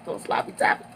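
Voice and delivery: cheeky whispered joke; a sly whisper to the audience; whispered aside. Real, not acted. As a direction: slip the naughty joke in under your breath. Cheeky aside about the food truck.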